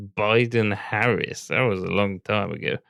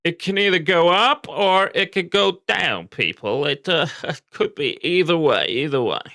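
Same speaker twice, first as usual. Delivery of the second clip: mocking imitation; 8 semitones higher, 4 dB louder than usual. Mocks the other streamer's hedging.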